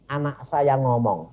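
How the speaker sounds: usual speaking voice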